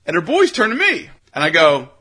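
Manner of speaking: ordinary delivery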